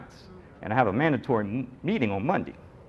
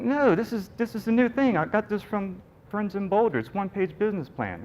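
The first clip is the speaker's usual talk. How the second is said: much higher, eager self-defense; eager defensive; 8 semitones higher than usual. Retold or imitated. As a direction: pitch it up, nervously insisting. Defending his plan to skeptic.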